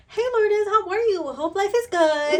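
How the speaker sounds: high, sweet, sing-song greeting; sugary text voice. Retold or imitated. Mocking a girl's overly sweet texts, high and sugary. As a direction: gush the greeting like a syrupy text. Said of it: "she's always like".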